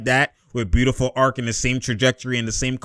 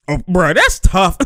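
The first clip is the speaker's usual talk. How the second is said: ordinary talk, nothing marked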